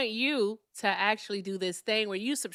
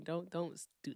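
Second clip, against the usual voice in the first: ordinary talk, nothing marked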